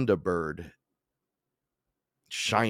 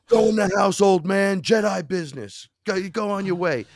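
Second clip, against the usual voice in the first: much higher and louder mock command; shouting; 12 semitones higher, 7 dB louder than usual. Telling grandpa to go inside, loud mock command. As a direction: yell at grandpa like a Jedi master. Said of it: "I go".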